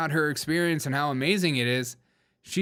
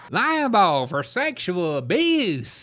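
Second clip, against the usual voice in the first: slow, exaggerated Southern accent in parody; hammed-up Southern stump speech; A O C's Southern accent; 3 semitones higher, slower than usual. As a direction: stretch every word in a mock Southern drawl. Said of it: "her new Southern accent".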